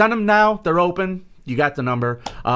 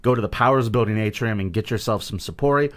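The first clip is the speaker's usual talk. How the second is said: ordinary talk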